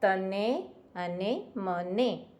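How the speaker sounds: very slow, spelled-out તને અને મને; slow rhyme demo. Shown as a demonstration. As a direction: drag the words out for the class. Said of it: "છેલ્લો શબ્દ સરખો આવ્યો".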